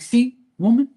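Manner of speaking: high, loud gloating call; mocking told-you-so.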